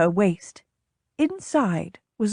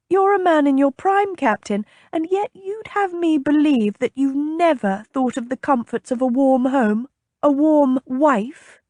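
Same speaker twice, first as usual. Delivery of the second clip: higher-pitched lady, pressing in disbelief; incredulous young lady; 6 semitones higher than usual. A narrator's reading. Young lady challenging her guard captain about marriage, incredulous.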